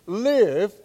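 Live said loud and high as the answer; triumphant one-word answer; stress on Live. Shown as a demonstration. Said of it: "the emphasis here in Galatians is on life".

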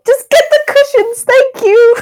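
high, loud, flustered demand; mock-indignant high-pitched yell; exasperated shout. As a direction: cut off the fussing, loudly. Embarrassed character snaps at being babied.

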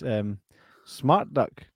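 usual speaking voice

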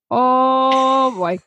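imitated Minnesotan accent. Friend mimicking her friend's TV-mom impression, drawn-out Minnesotan accent. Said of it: "exactly how you just sounded with".

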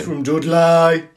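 slow, loud Brummie drawl; Brummie accent. Pretend. Echoing co-host on Lenny Henry's hometown, mock Brummie accent. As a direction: stretch Dudley out in broad Brummie. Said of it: "had a Brummie accent".

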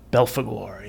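eerie whisper of the name. Acted. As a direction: breathe the name like a secret. Spooky aside after demon name.

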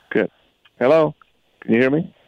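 normal talking voice

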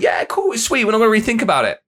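sudden high, carefree yeah cool; breezy mock cheer. Dieter shrugging off a good week, high and breezy. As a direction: toss it off like nothing needs checking. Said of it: "We're like".

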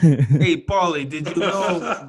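put-on slow, drawn-out wiseguy Italian accent; Sopranos-style Italian-American accent; mock Italian-American mobster. Joining the mobster impression bit.

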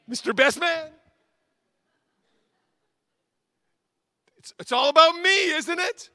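high, loud mock disbelief; hammed-up outrage. Preacher mocking the best man crashing the first dance, high and loud. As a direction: play up the absurd intrusion.

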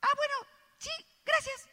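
vocecita agudísima y llorosa, suave; al borde del llanto. Acted. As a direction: acepta aguantando el llanto. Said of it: "empecé a llorar". (voz quebradiza de llanto contenido, muy aguda)

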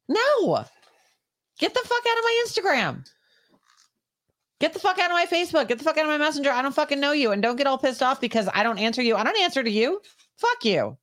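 high, heated rant at pushy strangers; very high-pitched furious outburst; angry rant. Real, not acted. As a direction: tell them off, fed up and furious. Ranting at online strangers.

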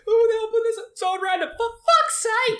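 high, loud exasperated cry, slowing on the curse; mock outraged yell. Friend acting out the pub owner, shrieking high in outrage.

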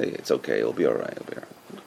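very low, hushed comforting; soothing reassurance. Retold or imitated. Visitor trying to pacify a mourner, soothing reassurance. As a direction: soothe softly, trailing away to almost nothing.

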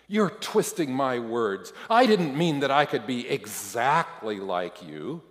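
loud, defensive outburst; impulsive retort. A narrator's reading. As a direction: snap back loudly in self-defence. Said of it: "I react impulsively".

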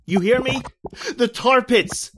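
terror rising; panicked. Acted. John trapped in tar, high panicked cry.

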